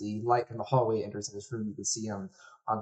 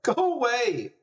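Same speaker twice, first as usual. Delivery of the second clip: put-on high, upset boy's shout; shouted through sobs; 13 semitones higher, 5 dB louder, slower than usual. Sobbing little brother shouts at whoever knocks, upset. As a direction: shout from inside the room, pitch way up. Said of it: "You just hear him shout".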